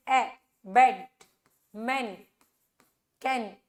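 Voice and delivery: ए, बेड, मैन, कैन said as separate examples of the short open 'e/a' vowel; short vowel demo. Shown as a demonstration. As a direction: isolate each word ए, बेड, मैन, कैन with one pure short vowel. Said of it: "लेकिन short है यहाँ पे". (ए, बेड, मैन, कैन में छोटा 'ऐ' स्वर, अलग-अलग बोलकर)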